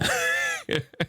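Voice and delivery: squeaky high laugh; high giggle. Real, not acted. Laughs at own singing.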